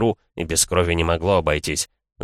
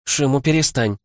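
firm, raised rebuke; stern mother silencing a child; 8 semitones higher than usual. A narrator's reading. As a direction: scold the boy sternly. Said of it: "сурово произнесла она".

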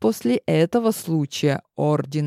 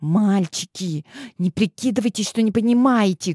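urgent whisper; 4 semitones higher than usual. Acted. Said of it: "горячо зашептала Клементина".

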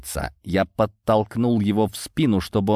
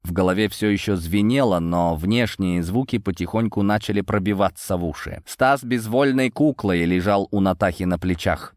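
ordinary delivery, nothing marked

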